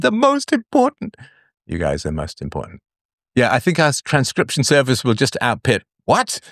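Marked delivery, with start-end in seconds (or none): 0.0-1.2 very high, slow, put-on voice for The most important; hammed-up emphasis. Joking self-correction, hammed up. 1.7-2.8 much lower and softer, You guys are most important muttered; quiet aside. Real, not acted. Quiet aside after the joke. 6.0-6.4 very high, puzzled What?; mock confused machine. Imitating confused transcription service.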